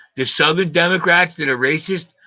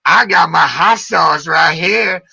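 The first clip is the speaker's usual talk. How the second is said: slow, loud mock drawl; loud, slow mocking Hillary voice; Hillary impression; 3 semitones higher, 6 dB louder, slower than usual. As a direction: ham up Hillary's put-on southern accent. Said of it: "Can you be Hillary?".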